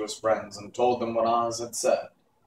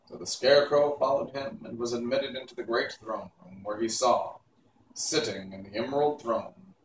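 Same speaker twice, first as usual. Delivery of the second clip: plain reading voice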